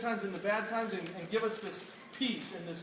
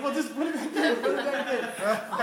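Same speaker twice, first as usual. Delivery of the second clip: laughing urging; 5 semitones higher, 6 dB louder than usual. Retold or imitated. Telling brother to reattach fingertip.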